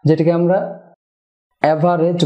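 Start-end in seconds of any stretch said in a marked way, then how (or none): 1.6-2.2 "অ্যাভারেজ" as a-va-rej; commonly said average. Shown as a demonstration. Teacher quotes what we usually say, "অ্যাভারেজ" as a-va-rej. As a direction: keep the middle vowel in "অ্যাভারেজ". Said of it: "বলে থাকি".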